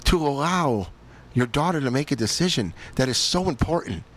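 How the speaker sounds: the other voice in his head demanding he take his daughter to church; stern inner voice. Retold or imitated. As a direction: challenge yourself like a scolding conscience. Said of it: "And then the other one's saying".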